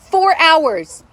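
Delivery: fed-up outburst at the wait; exasperated. Real, not acted. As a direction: blurt it out in disbelief. Exasperated about hold time.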